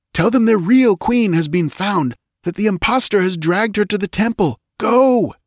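high, urgent horrified command; horrified soldier. Acted. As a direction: dread turning to orders. Valerius horrified then commanding.

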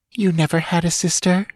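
high, sugary mockery; sweet, poisonously mocking. A narrator's reading. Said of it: "came the sweet, poisonously mocking voice".

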